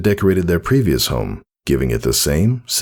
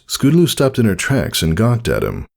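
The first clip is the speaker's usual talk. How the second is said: plain reading voice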